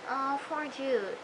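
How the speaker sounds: slow, soft pity for Jude; drawn-out, quiet poor Jude; sympathetic sigh. Retold or imitated. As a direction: say it like your heart goes out to him. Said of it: "I'm just like".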